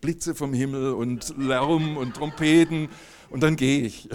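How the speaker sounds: asking Jesus for a big sign, slow and loud; mock demanding. Teasing Jesus, demanding a loud sign slowly. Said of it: "hab ich wirklich so gesagt".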